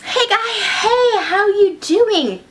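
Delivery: high, drawn-out hello; nervous greeting. Acted. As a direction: coax a dangerous creature with a hello. Doctor nervously greeting the monster.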